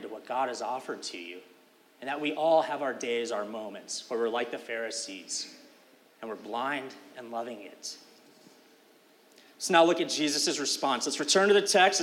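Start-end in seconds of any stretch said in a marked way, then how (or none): none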